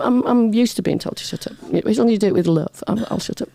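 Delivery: ordinary delivery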